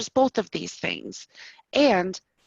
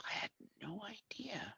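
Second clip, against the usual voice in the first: whispered aside; 21 dB quieter than usual. Acted.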